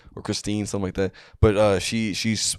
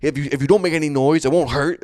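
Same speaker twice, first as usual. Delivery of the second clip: higher, harsher mock scolding; imitated angry leader, raised pitch; getting mad; 7 semitones higher, 4 dB louder than usual. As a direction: scold the crowd into silence. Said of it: "he starts getting mad".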